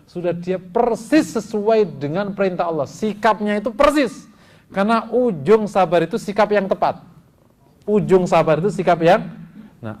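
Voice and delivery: loud, high, emphatic sermon; forceful preaching. Real, not acted.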